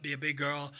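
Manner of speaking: normal talking voice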